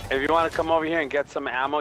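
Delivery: ordinary talk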